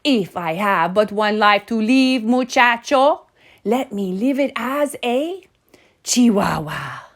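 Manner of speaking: hammed-up Spanish accent. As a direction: kitten grandly putting on a Spanish accent. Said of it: "using his very best Spanish accent".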